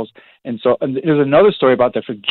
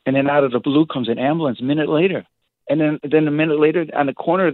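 ordinary talk

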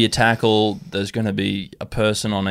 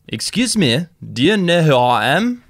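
higher, louder, fishing to be recognised; eager celebrity; 7 semitones higher, 5 dB louder than usual. Acted. Faf on the plane asking the stranger, higher and eager. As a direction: ask it with raised hopeful pitch.

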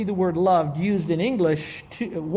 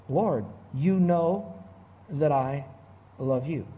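slow reply broken by pauses; hesitant, spaced-out reply; slow, halting quote; 3 semitones lower, 4 dB quieter, slower than usual. Retold or imitated. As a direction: let the quoted answer drop away softly, piece by piece.

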